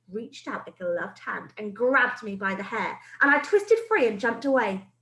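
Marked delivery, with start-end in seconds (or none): none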